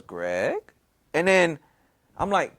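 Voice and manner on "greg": low, softer name with rising end; girlfriend's eerie call. Retold or imitated. Girlfriend calls his name in the dark, low and questioning. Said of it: "Like that, right?".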